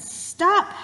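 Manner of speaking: high, loud single-word shout; mouse's yell. Acted. Teacher voices the mouse while writing its words, high shout. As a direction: call it out as the yelling mouse. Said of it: "this little mouse was saying to my cat".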